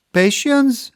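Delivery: sudden high, loud exclamation; exclaimed. A narrator's reading. Ardent teasing the doubting captain, excited exclaim. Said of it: "exclaimed Ardent".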